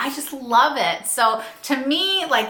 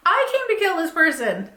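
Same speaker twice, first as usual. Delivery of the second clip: mock surprise; 5 semitones higher than usual. Acting out surprised meeting another killer.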